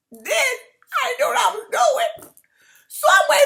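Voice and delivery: cracking up loudly at her own mistake; laughing. Real, not acted. To viewers about filing the wrong waiver, laughing out loud. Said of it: "The reason why I'm laughing".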